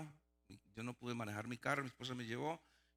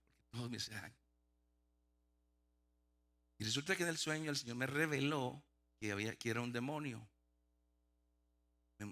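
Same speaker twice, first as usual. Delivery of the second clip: ordinary talk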